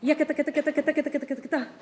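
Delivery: fast rattling syllables, laughing through them; rapid rattle with a laugh. Shown as a demonstration. Conductor demonstrates the mezzorato, fast and laughing. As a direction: rattle it off quickly, half laughing. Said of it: "The mezzorato of nine".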